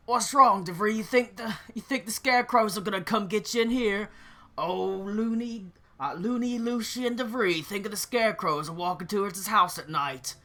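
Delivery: loud pub bully's ridicule; mocking taunt. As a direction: tease him like a bully playing to his laughing friends. Bully taunts Lucien after prank.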